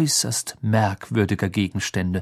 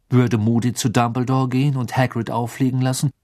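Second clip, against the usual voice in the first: ordinary delivery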